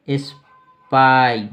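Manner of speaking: स्पाई very slow and loud, split as "is-paai"; pronunciation demo. Shown as a demonstration. Teacher showing how to pronounce it, slow drawn-out स्पाई. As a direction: stretch स्पाई into two emphatic parts. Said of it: "कैसे pronounce कर सकते हैं".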